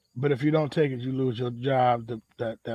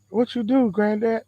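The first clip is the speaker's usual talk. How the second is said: put-on higher-pitched child's question; a hint of a curious grandkid, pitched up; lightly voiced grandkid; 7 semitones higher than usual. Grandkid asks granddad about his work, lightly voiced higher.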